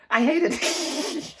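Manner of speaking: half-laugh through it; laughing complaint. Real, not acted.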